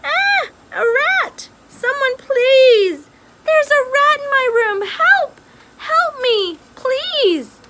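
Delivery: high, loud, drawn-out cries for help; screaming for help. A narrator's reading. Princess screaming for help about the rat, high panicked shouts.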